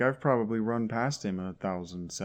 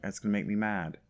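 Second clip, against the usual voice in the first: ordinary talk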